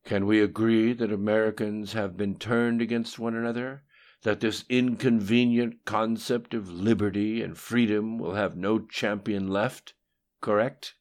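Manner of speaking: demanding agreement. A narrator's reading. The voice presses Lim Shun to agree, insistent. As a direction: press for the only allowed answer. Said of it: "as though only one answer was allowed".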